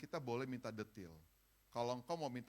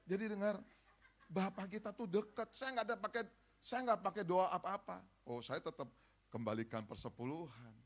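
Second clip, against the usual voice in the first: ordinary talk, nothing marked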